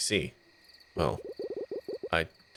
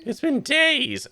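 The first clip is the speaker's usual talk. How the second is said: very high, slow, motherly scolding voice; shrill worried mother calling her kids, drawn out; fussy mom voice; 4 dB louder, much slower than usual. Acted. Mom character scolds son.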